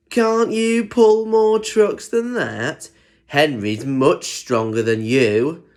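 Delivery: high, slow mocking truck voice; teasing. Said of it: "they began to tease him".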